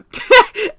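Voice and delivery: high, loud burst of laughter; sudden bright laugh. Real, not acted. Laughing at the pronunciation.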